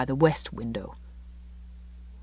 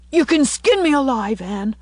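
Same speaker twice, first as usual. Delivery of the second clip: put-on high, loud childish vow; vehement little boy; 8 semitones higher, 5 dB louder than usual. Davy offers Anne a penance, vehement boy. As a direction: offer the penance with dramatic earnestness.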